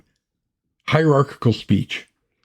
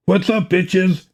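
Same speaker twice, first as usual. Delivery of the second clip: casual yell; 7 semitones higher, slower than usual. Acted. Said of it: "he yelled".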